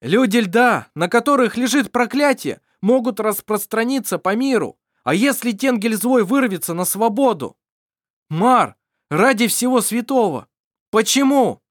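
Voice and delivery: high, loud urgent appeal; urgent plea. Acted. Daniel urgently pleading with Mar, high and loud. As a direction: press him hard.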